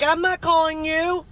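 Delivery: high-pitched call across the house; mom hollering back. Retold or imitated. Mom denying she called her kid, imitated holler.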